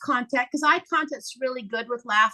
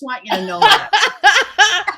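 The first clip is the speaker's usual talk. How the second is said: laughing while speaking; 6 semitones higher, 7 dB louder, much slower than usual. Real, not acted. Joke about naked mirror laughing.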